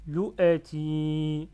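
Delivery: LOU A TI loud and linked, long final i; joined syllables. Shown as a demonstration. Teacher removes barriers between syllables, linked and drawn out. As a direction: slowly join LOU A TI, holding ti. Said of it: "j'enlève les barrières une à une".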